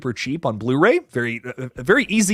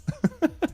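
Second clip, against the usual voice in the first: short laugh; 11 semitones higher, 9 dB quieter than usual. Real, not acted. Laughs after dark joke.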